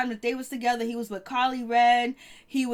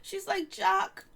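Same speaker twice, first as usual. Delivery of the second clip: mock crying; 9 semitones higher, 3 dB quieter, slower than usual. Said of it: "she's crying over here".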